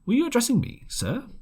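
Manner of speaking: a hint of a polite doctor; doctor's reply. A narrator's reading. Doctor answers the captain, lightly voiced polite challenge.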